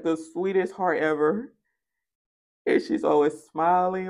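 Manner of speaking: ordinary talk, nothing marked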